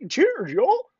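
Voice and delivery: a light, hammed-up Southern drawl on y'all; goofy Southern accent. Real, not acted. Greeting the chat in a goofy put-on accent. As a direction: toss off cheers, y'all like a yokel. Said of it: "I did a goofy accent".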